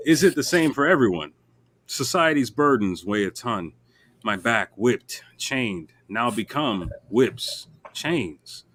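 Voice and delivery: slow spoken-word poem; slow poem reading. Acted. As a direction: recite it slowly, letting lines land. Reads his poem aloud.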